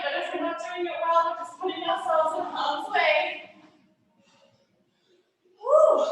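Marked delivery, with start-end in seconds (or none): 5.6-6.0 loud, high exhaled whoop; effortful whew. Real, not acted. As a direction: blow out the effort with a high cry. Hard effort mid workout.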